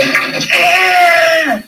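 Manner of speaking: a high, loud anguished scream; retold scream. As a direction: cry out like the uncle in agony. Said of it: "He was screaming".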